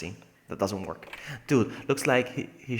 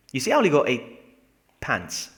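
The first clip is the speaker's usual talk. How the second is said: usual speaking voice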